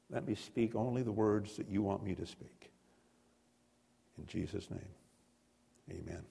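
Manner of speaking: soft, low, unhurried prayer voice; hushed pastor's prayer before the sermon. Acted. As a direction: pray quietly with bowed head. Leads a prayer.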